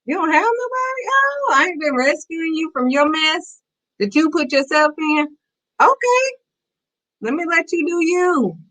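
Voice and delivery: very high, incredulous mock offense; God, taken aback. God answering someone who claims to have nobody, mock offended. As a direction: squeal in exaggerated, sarcastic disbelief. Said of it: "And God like".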